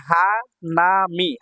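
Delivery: Hanami slow, each syllable held. Shown as a demonstration. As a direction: draw out hanami in three beats. Teacher sounds out new word.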